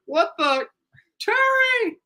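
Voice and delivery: very high-pitched, excitable announcer yelp; hammed-up falsetto commentator impression; Eric Collins. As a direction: squeal it like a stunned play-by-play man. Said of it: "Even he's like".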